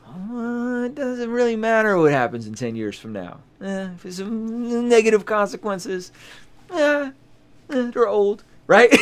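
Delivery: high, slow, dismissive put-on voice; callous shrug. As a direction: shrug off the old folks like it's nothing. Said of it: "So he said".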